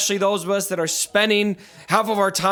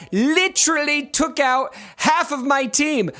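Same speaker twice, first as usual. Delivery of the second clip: put-on English accent; 7 semitones higher than usual. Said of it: "I went into an English accent saying that".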